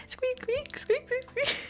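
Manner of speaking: very high, tiny squeaks; bunny noises. Pretend. Streamer doing bunny squeaks for chat, very high. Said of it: "Like, bunny noises".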